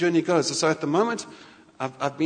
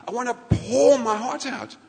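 heartfelt plea; 8 semitones higher, 4 dB louder, slower than usual. Acted. God's plea voiced, heartfelt.

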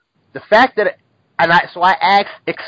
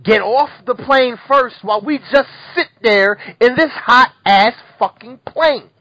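building angry rant; 6 semitones higher, 6 dB louder than usual. Real, not acted. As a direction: voice climbing higher and louder with rage. Angry rant about hot plane.

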